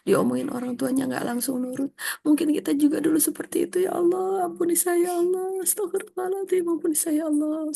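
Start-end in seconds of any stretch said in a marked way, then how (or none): 3.8-7.8 high, lightly acted pleading to God; mock repentant prayer. Parent begging God to forgive past disobedience, high mock plea. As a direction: cry out for forgiveness, half-playfully.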